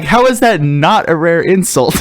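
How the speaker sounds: high-pitched disbelief through laughter; laughingly indignant retort; incredulous protest. Retold or imitated. Retold protest over rejected insult.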